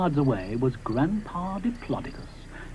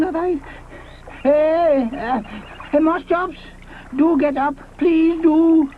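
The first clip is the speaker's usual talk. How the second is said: fussy Mister Ichthyosaurus; 10 semitones higher than usual. Acted. Mister Ichthyosaurus sees Moschops down, high fretting voice. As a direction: flap and fuss, worried.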